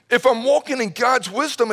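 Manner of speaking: usual speaking voice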